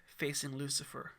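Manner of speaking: ordinary delivery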